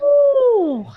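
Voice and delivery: high, loud "ooh"; long high-pitched cheer; excited whoop. Real, not acted. Cheering a dice result.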